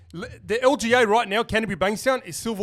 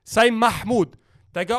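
ordinary talk, nothing marked

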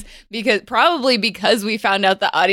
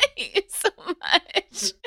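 laughing; 11 semitones higher, slower than usual. Real, not acted. Laughing at partner's joke.

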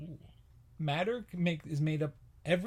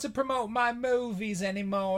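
high Aussie actress voice; hammy Australian impression; mock Australian accent; 6 semitones higher than usual. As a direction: explain in a high put-on Australian voice. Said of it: "And I'm Margot Robbie".

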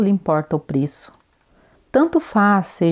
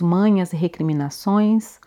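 ordinary delivery

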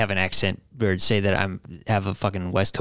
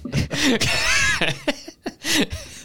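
high shrieking laugh. Real, not acted. Laughing at the scat.

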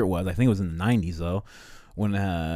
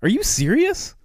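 much higher, louder incredulous squeak; squeaky disbelief; 6 dB louder than usual. Retold or imitated. Imagined MLK told it's still happening, incredulous squeak. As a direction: shriek it in disbelief.

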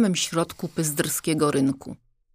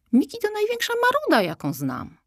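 exasperated. Acted. Zosia complaining about Miki.

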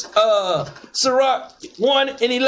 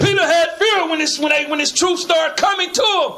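raised, higher preaching voice; fiery preaching; 4 semitones higher than usual. Acted. Preacher explaining Peter's fear to the reader, raised preaching voice. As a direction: drive the point home like a street preacher. Preaching shout over the reading.

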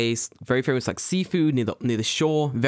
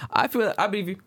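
normal talking voice

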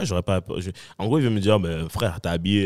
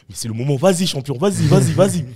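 building cheer, louder toward the end; egging him on; 8 semitones higher, 6 dB louder than usual. Retold or imitated. Friends at the party urging the guy to speak, rising excited cheer. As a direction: hype the brave one up like a crowd.

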